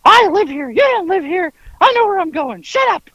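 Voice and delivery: high-pitched exasperated snapping; mock-irate squawk at a passenger. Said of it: "he snaps at Frankie and says".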